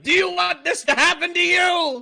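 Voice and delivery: high, loud threatening taunt; menacing taunt. Retold or imitated. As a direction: wave the dead rat at them and taunt. Threatening rats with dead rat.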